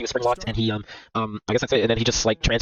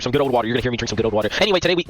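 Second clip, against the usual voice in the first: usual speaking voice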